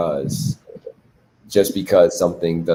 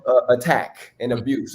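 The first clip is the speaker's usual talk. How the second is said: usual speaking voice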